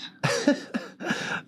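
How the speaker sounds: a high, airless burst of laughter; silent wheezing laugh. Real, not acted. Host caught off guard by the caller's joke, wheezing laugh. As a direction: crack up without a voice.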